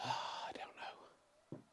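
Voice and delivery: sighing whisper, slow and unvoiced. Real, not acted. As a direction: breathe it out as a whisper. Confused, drops out of commentary.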